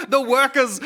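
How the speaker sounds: high mock-Irish cry through laughter; laughing Irish champion of the workers; Irish advocate. Parodying Irish campaigners for the poor, high laughing Irish accent. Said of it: "in their Irish accent".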